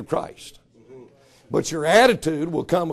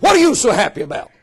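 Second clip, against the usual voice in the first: grumpy snap at a cheerful man; annoyed, louder and higher; irritated; 4 semitones higher, 6 dB louder, faster than usual. Retold or imitated. Grumpy man snapping at singer.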